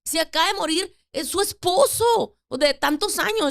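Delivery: emphatic retort. Retold or imitated. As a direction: insist she be allowed to cry, voice lifted high. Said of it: "Entonces yo le digo".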